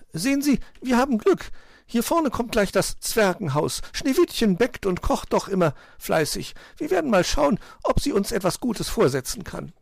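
high, lively bird voice; confident magpie. A narrator's reading. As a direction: announce it high and pleased as a magpie. Magpie announces dwarfs' house.

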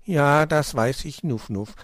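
ordinary delivery

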